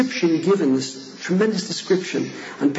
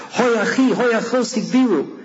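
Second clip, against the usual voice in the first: anguished cry of recognition; 7 semitones higher than usual. Acted. Brother and sister recognize each other at dawn, high anguished cry. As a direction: cry out the moment you recognize your sibling.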